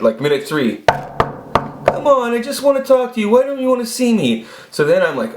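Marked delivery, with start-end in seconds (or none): 1.9-4.5 high, imitated female plea; high-pitched pleading woman. Woman begging the hiding man to talk, high mock plea. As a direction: coax him in a put-on high voice. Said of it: "this is going on for a minute after minute".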